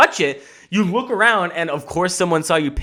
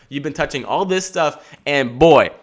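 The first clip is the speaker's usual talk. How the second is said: ordinary delivery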